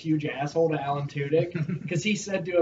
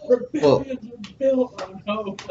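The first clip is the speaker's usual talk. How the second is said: slow, higher and louder, hamming up a cheesy movie line; mock dramatic line; 6 semitones higher, 5 dB louder, slower than usual. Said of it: "They really were like".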